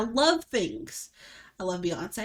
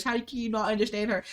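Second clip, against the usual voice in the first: normal talking voice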